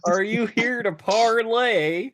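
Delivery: high, slow, hammed-up Southern drawl; mocking the fake Arthur Morgan drawl; bad Southern drawl. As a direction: stretch it out in a terrible Southern accent. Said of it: "the worst Southern drawl that anyone has ever heard".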